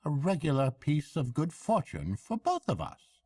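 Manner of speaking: high, hearty barge woman; bright country woman chatting. A narrator's reading. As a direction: barge woman offering a lift.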